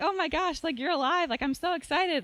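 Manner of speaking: high-pitched acted excitement; excited sisters' squeal of joy, in words; amazed, overjoyed. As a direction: gush in amazed delight. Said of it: "they're just amazed".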